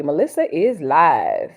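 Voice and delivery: usual speaking voice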